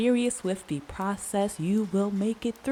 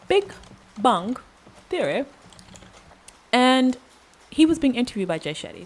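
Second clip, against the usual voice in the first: put-on a light Indian accent, pitched higher; sudden accent; 5 semitones higher, 4 dB louder, faster than usual. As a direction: slip into a playful Indian accent. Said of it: "this accent is coming".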